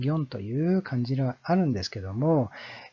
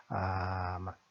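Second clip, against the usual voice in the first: usual speaking voice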